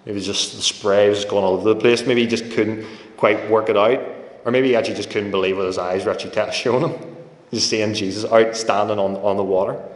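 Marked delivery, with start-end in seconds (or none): none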